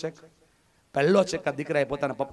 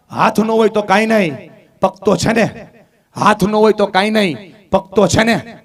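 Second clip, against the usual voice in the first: louder, higher, defiant repetition; proud loud answer; 6 semitones higher, 12 dB louder than usual. Retold or imitated. Mother teaching her daughter to answer proudly, loud. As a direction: say it proudly so everyone hears. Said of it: "એટલે મમ્મીએ કીધું".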